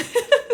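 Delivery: short, loud, high laugh; self-amused burst of laughter. Real, not acted. Streamer amused at crying on the internet, laughing.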